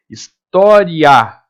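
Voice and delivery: "História" drawn out slowly, the A strong and the I weak; teacher stretches the word for the class; slow syllable demo. Shown as a demonstration. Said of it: "O A sai mais forte que o I".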